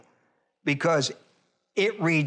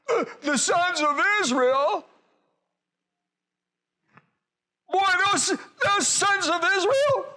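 high, slow, crying whine; complaining prophet; 11 semitones higher, slower than usual. Retold or imitated. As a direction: moan it to God like a hurt prophet.

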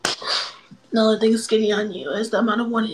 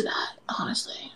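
whispering; 11 dB quieter than usual. Real, not acted. Flirty aside to stranger.

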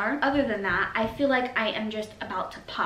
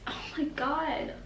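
choked up; 6 semitones higher, 5 dB quieter, slower than usual. Real, not acted. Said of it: "without crying".

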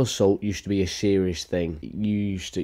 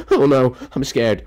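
louder, higher fake fright; mock scared; 5 semitones higher, 6 dB louder than usual. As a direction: play frightened, hammed-up. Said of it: "She just says".

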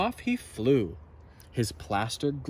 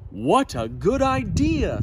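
louder eager thanks; Zog excited; 6 semitones higher, 6 dB louder than usual. A narrator's reading. Zog accepting the princess, louder and eager. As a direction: jump at the chance. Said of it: "said Zog".

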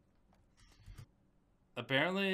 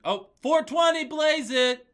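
meme shout; 10 dB louder, slower than usual. Pretend. Meme shout mid-chant.

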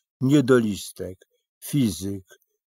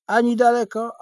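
high character voice; 7 dB louder than usual. Acted. Little man's riddling answer.

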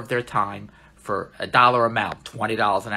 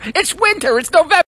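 exasperated; 13 semitones higher, 6 dB louder than usual. Real, not acted. Exasperated at follower's complaint.

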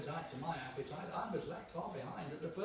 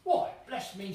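mock amazement; 6 semitones higher, 10 dB louder, slower than usual.

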